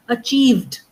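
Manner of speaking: "Achieved" with both final V and D audible; clearly sounded V and D. Shown as a demonstration. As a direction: finish "Achieved" on a lip-bitten V, then a distinct D. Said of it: "both have to be very clearly audible".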